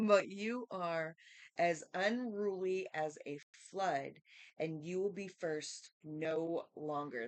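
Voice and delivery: slow, loud, declaiming Bible reading; emphatic scripture reading. Acted. Jacob rebuking his firstborn Reuben, read out emphatically. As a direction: proclaim the verse with weighty stresses.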